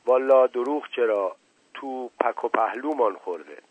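ordinary delivery

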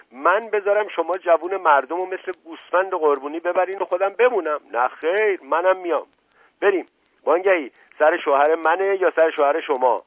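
high-pitched, forceful protest; decisive indignation. A narrator's reading. Wife interrupts the inspector, insisting she come, indignantly decisive. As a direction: cut in and allow no argument. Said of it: "با لحن قاطعی کلام او را برید".

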